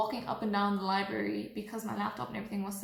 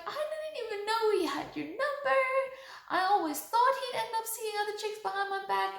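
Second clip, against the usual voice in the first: high, upset, near-crying imitation of the friend; imitating tearful friend; 10 semitones higher than usual. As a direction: play the friend sobbing out the betrayal, pitched way up. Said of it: "in tears, super upset".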